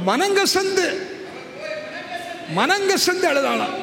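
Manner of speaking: high, forceful stress on மனங்கசந்து; emphatic preaching cry. Real, not acted. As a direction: cry out the key word to the congregation. Preacher stresses verse about bitter weeping.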